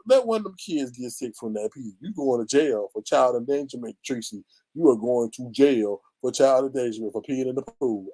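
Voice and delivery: normal talking voice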